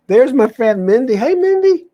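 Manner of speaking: light up and call her name; excited greeting. Real, not acted. Greeting her friend Mindy who just joined, high excited call.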